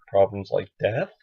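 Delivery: "death" rising like a question; unsure, asking lilt on "death"; questioning tune. Real, not acted. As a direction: lift the pitch at the end on "death". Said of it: "supposed to sound like a question".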